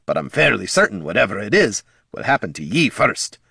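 plain reading voice

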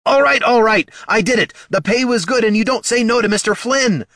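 high, loud, pleading confession; panicked cry. A narrator's reading. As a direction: blurt it out in panic. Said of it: "Barty cried".

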